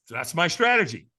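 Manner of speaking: usual speaking voice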